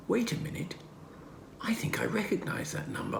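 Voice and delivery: high, hushed whisper; father whispering over a phone number. Acted. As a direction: murmur to yourself while puzzling it out. Father studies suspicious card.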